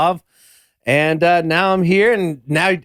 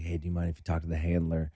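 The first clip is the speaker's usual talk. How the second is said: much softer, fast nervous ask; nervous whisper; 10 semitones lower, 14 dB quieter, faster than usual. Retold or imitated. Guest nervously asking manager, softly hushed. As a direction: hush it to your manager.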